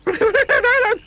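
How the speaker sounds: high squeak of fright; startled yelp. A narrator's reading. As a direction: jump at the snap. Twig snap frightens Jelly.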